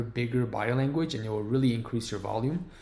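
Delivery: normal talking voice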